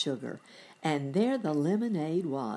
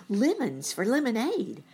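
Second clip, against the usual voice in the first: higher-pitched, thirsty reply; thirsty eagerness; 7 semitones higher, 3 dB louder than usual. A narrator's reading. Sammy replying to the elephant, thirsty eagerness. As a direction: answer as if longing for a drink. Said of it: "thirsty-like".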